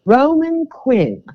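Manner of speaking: slow, drawn-out try at a British accent; a weak British accent attempt. Shown as a demonstration. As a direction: say the name like an F1 commentator, British. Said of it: "let's see if I can do this British".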